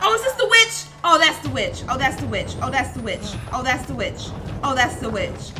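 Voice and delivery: loud, high scared repeating; frightened at the witch. Real, not acted. Witch appears in horror game.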